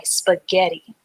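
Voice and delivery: normal talking voice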